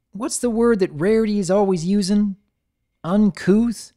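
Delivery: high puzzled country pony; searching aloud for a fancy word; folksy wondering; Applejack voice. Acted.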